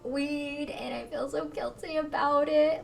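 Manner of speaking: put-on a sobbing teen confessing her sins; retold crying. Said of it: "literally crying".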